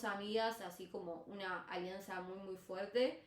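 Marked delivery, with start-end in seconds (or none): none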